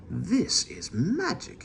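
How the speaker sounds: slow, wondering and high; a hushed, amazed voice; awed. A narrator's reading. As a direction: breathe it out in wonder. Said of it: "he said".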